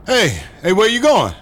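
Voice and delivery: hey, wait, where are you going; calling after him. Acted. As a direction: shout after him as he goes. Calling after Michael leaving.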